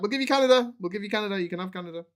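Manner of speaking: high, loud mock French eagerness fading down; eager French offer. French offering Canada to the British, high and loud, then calming. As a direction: blurt it out, then trail off. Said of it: "they're like".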